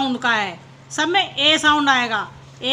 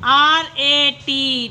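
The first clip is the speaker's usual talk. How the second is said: slow letter-by-letter spelling; much slower than usual. Shown as a demonstration. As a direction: spell ए पी slowly, each letter apart. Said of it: "teacher spells word slowly".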